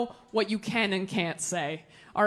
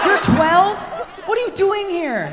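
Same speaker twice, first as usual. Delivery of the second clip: much higher and louder, squeaked question; shocked disbelief; 7 semitones higher, 3 dB louder than usual. Real, not acted. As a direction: hit it as a stunned double-take. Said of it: "shocked at a child in audience".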